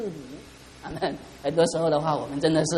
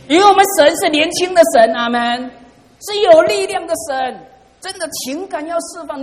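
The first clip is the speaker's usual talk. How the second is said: higher and louder, proclaiming with force; fervent preaching; 10 semitones higher, 8 dB louder than usual. Acted. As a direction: preach it out to the whole room. (激昂的讲道)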